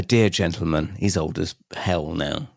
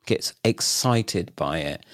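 ordinary delivery

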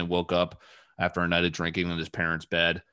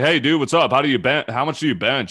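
higher, louder mock-chummy questions; retold eager pestering; 4 semitones higher, 6 dB louder than usual. Fan pestering a football player with questions, mock eager. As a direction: pepper him with over-friendly questions.

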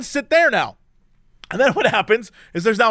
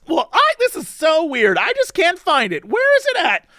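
high, dithering mock-confused voice; imitating a flustered woman; 8 semitones higher than usual. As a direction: play the oblivious traveler, high and fumbling. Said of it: "She's like".

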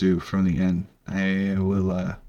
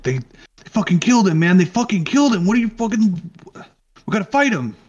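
much higher, louder frantic yelling; panicked thug begging to fight; agitated Muscle; 11 semitones higher, 3 dB louder than usual. Acted. The Muscle protesting the party leaving, frantic yell.

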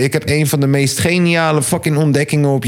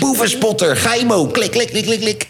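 high-pitched catchphrase impression; Boevenspotter; 6 semitones higher than usual. Host mimicking the thief spotter's sign-off, high playful impression. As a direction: rattle off the catchphrase like an eager snitch, pitched up. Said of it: "De boevenspotter".

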